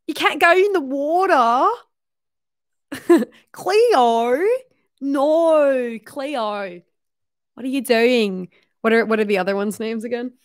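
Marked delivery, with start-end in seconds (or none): 0.1-1.7 high-pitched, swooping, hammed-up Australian; mock Australian accent. Imitating H two O show calling to Cleo, mock Australian accent. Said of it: "everyone who's saying H two O". 3.5-8.5 playful Australian impression, swooping then dropping; mock Australian accent. Imitating H two O show warning Cleo, mock Australian accent. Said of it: "everyone who's saying H two O".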